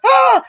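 high, loud mock scream; Dorothy screaming. Dorothy jumping into the overflowing river, high loud scream. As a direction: leap with a shriek. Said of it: "And Dorothy's like".

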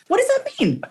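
squeaky high puzzled question; mock baffled. Laughing at her own nonsense, high incredulous question. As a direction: pitch it way up, mock confused. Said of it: "you're like".

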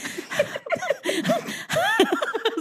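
breathless high wheezy giggle; wheezing laugh. Real, not acted. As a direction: laugh until you wheeze. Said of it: "like, wheezing now".

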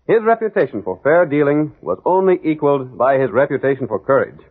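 ordinary delivery

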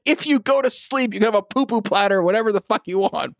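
high-pitched mock-desperate promise; exasperated parent bargaining. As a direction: plead like a worn-out parent at bedtime. Parent bargaining with child at bedtime.

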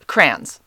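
"crayons" said as one short syllable, crans; own pronunciation of crayons. Shown as a demonstration. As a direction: say "crayons" as "crans", rhyming with fans. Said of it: "That's how we say it".

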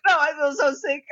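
high-pitched retold wail; panicked distress. Panicking passenger answering flight attendant, panicked distress. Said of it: "I had a panic attack".

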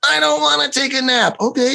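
put-on high defiant little girl; bratty child. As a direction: whine back like a spoiled kid. Child refuses nap.

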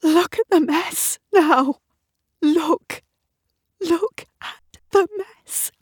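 very high, anguished wail; crying with self-pity. A narrator's reading. Woman blaming herself at the mirror, wailing with self-pity. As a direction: sob it out, high and strained, breaking down. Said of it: "cries with such self-pity".

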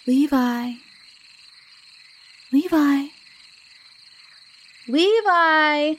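rising in pitch from call to call. A narrator's reading. Girl looks for her missing friend, calling out. Kingsley searches for missing Levi.

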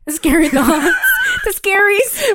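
high-pitched giggling repeat; cracking up over a silly phrase, voice shooting up; laughing. Real, not acted. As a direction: squeal it through laughter. Laughing at her friend's phrase.

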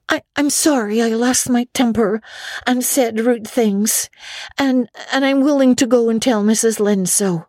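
put-on a girl owning up to her temper; contrite Anne. A narrator's reading. Anne's apology.

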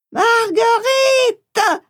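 long, drawn-out high call of a name; mother calling out. Acted. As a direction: call her from far off, stretching the name slowly. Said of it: "C'est ma mère qui m'appelle".

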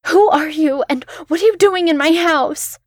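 frightened yell. A narrator's reading. Woman yells at the voice under her bed, terrified. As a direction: yell it at the dark, panicked. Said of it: "I yelled".

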